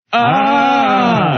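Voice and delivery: a sudden high yell, Hyde-style; hammed-up monster ah; mock monster roar. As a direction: jump out with a high shout. Imitating monster roar in cartoon.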